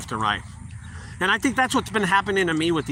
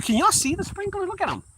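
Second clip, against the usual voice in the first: excited pointing. Real, not acted. Showing sprinklers to viewers.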